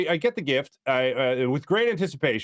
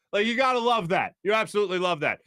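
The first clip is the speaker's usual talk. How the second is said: normal talking voice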